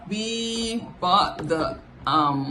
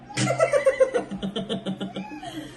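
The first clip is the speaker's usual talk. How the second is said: high laugh. Real, not acted. Laughing at husband's line.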